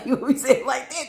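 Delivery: high, fast, laughing put-on voice; high silly take. Shown as a demonstration.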